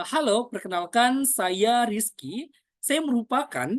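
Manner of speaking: slow introduction, "saya Rizky" drawn out and rising; public-speaking intonation. Shown as a demonstration. Coach models introducing himself to an audience, deliberate intonation. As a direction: stretch "saya Rizky", lifting each phrase end. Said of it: "merupakan yang namanya intonasi".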